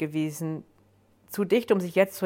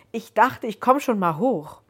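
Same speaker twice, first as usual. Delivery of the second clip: raised voice, explaining after a scare; apologetic explaining; 5 semitones higher, 5 dB louder than usual. A narrator's reading. Visitor explaining the climb to Joan, apologetic and raised. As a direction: lift the pitch, a little startled.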